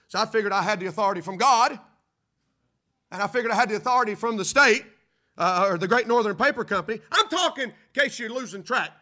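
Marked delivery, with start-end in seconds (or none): 1.4-8.9 loud, building sermon climax; rally the congregation, louder and louder; preaching at full force. Acted. Preacher driving home the spiritual warfare point with loud fervor.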